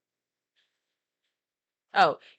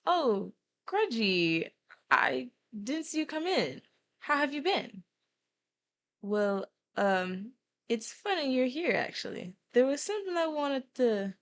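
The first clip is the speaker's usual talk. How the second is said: higher-pitched, hesitant acquaintance; friendly greeting in character; 6 semitones higher than usual. Acted. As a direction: greet in a raised voice, then hesitate. Voices a character greeting Grudge.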